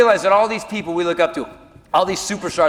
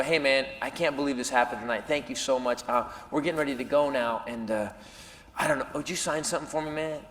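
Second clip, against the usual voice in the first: timid ask; 5 semitones lower, 11 dB quieter than usual. Retold or imitated. Nervous fan asking autograph.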